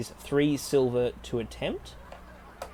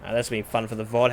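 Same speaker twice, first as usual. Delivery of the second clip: normal talking voice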